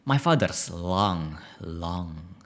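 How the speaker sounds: slow, drawn-out dramatic voice; slow theatrical delivery, sinking at the end; dramatic reading. Shown as a demonstration. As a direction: stretch the words out, dramatically slow. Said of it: "in a more dramatic voice".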